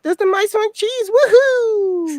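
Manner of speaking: put-on very high squeaky anime girl voice; high cute anime girl. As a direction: squeal it like a cutesy anime character. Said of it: "acting like anime characters".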